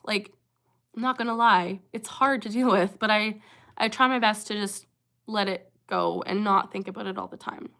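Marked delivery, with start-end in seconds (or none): none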